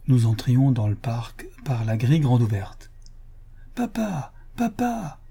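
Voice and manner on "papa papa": high breathy call of "Papa!" from afar. A narrator's reading. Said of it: "cria la voix de Suzanne".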